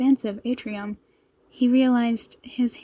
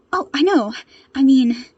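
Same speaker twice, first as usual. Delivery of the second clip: blurted, raised and nervous; flustered boy; 2 semitones higher, 9 dB louder than usual. Acted. Nervous boy greeting Shoto, flustered. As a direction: blurt it then catch yourself.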